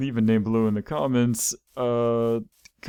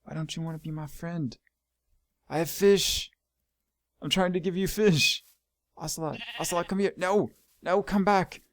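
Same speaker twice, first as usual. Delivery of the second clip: pleading with pet; 7 semitones higher than usual. Real, not acted. Ocelot runs off, higher whiny pleading. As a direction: beg the cat not to leave.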